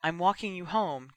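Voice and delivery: ordinary delivery, nothing marked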